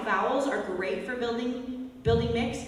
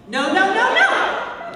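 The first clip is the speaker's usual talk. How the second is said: higher, louder scolding no; mock mad at the dog; 10 semitones higher, 9 dB louder, much slower than usual. Shown as a demonstration. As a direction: shake your finger and bark no. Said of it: "Kind of bark at them".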